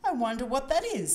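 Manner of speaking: puzzled, mysterious storybook voice; a musing hmm, then intrigued wondering. A narrator's reading. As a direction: wonder aloud with a touch of mystery. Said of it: "in a mysterious voice".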